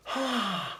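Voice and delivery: high whistle-like hoot; vocal train whistle sound; train whistle imitation. Shown as a demonstration. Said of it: "You gotta go".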